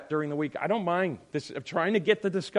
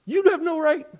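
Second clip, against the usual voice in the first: high, loud offended protest; mock outraged high shout; indignant outburst; 13 semitones higher, 8 dB louder than usual. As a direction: snap back, deeply offended. Acted indignant reply about zipper.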